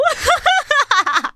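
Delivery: quick burst of real laughter; laughing. Real, not acted. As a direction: giggle at your own joke. Laughing at own joke.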